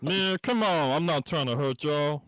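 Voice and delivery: loud, hammed-up street voice. Shown as a demonstration.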